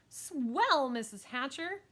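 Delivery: high, loud delight; eager girl. Acted. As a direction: gush to the grown-up.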